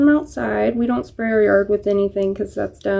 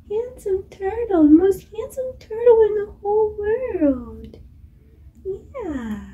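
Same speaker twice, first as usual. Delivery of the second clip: high, gushing coo at a pet turtle; 9 semitones higher than usual. Real, not acted. Cooing praise at pet turtle.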